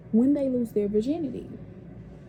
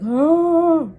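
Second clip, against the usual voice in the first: short, loud, higher cry of pain; 7 semitones higher, 8 dB louder than usual. Retold or imitated. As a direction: yelp as it first hurts. Retelling pain at first.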